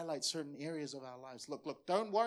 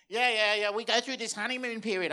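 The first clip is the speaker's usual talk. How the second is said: loud, higher, mocking skeptic; mocking doubter voice, pitched up and loud; critic's voice; 6 semitones higher, 10 dB louder than usual. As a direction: sneer it like a know-it-all critic. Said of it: "I do the critic's voice".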